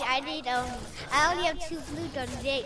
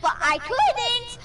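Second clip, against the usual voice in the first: yelling; 9 semitones higher, 10 dB louder, much slower than usual. Real, not acted. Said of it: "you don't need to freaking yell".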